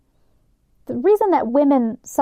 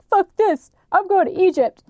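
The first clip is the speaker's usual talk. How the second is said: higher-pitched mock exasperation; retold anger; 7 semitones higher than usual. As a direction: play him fed up, pitched up. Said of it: "he got so mad that".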